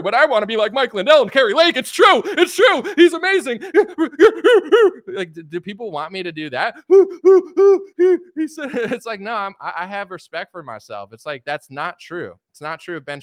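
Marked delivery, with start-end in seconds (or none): 0.0-3.6 very high, loud gushing squeal; fawning fan parody. Retold or imitated. Pundit pretending to adore Trump, shrill squealing mock praise. 3.6-5.0 loud high burst of laughter. Real, not acted. Vlogger laughing at her own parody, high loud laugh. 6.8-8.3 loud high burst of laughter. Real, not acted. Vlogger laughing at her own parody, high loud laugh.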